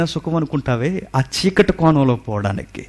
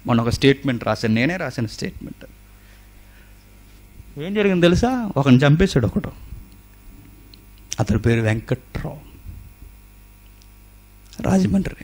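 normal talking voice

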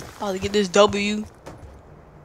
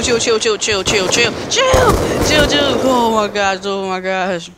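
ordinary talk, nothing marked

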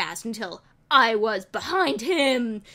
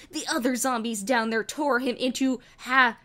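ordinary delivery, nothing marked

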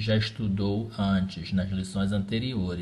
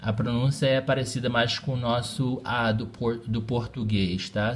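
normal talking voice

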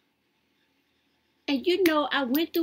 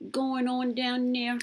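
ordinary talk

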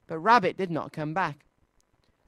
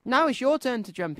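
raised-pitch call; 7 semitones higher than usual. A narrator's reading. Christopher Robin to Tigger in the tree, mild high call. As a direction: lightly call up to someone above. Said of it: "called up to Tigger".

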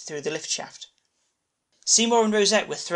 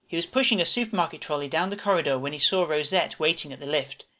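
usual speaking voice

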